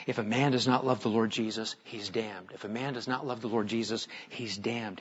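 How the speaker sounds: soft, low, brooding repetition; hushed inner voice. Retold or imitated. Old man brooding on a verse.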